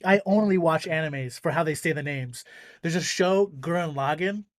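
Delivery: ordinary talk, nothing marked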